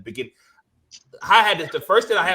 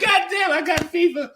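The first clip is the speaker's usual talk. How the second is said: much higher, startled cry; shocked; 13 semitones higher, 3 dB louder than usual. Retold or imitated. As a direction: squeal it out in disbelief. Retold shock at the fever.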